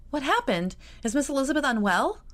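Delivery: put-on high, anxious girlish questions; worried. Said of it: "Georgiana began to worry".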